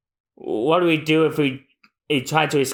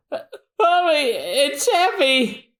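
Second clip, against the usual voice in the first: much higher, crying complaint; strained whine; 14 semitones higher, much slower than usual. Acted. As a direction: whine under a heavy load. Usopp struggling with load.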